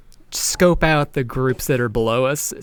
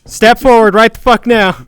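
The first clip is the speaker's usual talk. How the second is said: loud, raised command; angry order; 6 semitones higher, 7 dB louder than usual. Acted. Rider orders the hidden heckler forward, loud angry command. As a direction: demand it from a defiant crowd.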